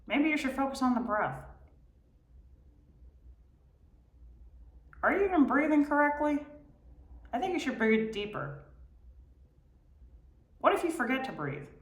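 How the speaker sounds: high, fast, fretful brain voice; nagging inner voice. Retold or imitated. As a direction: pester like an anxious mind. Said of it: "I don't know why I'm using this voice".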